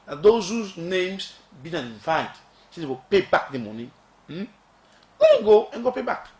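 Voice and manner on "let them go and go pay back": sudden high, loud scoff; scornful outburst. Real, not acted. To officials with queries, a high loud scornful jab. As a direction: throw it out in scorn. Mocking officials told to repay.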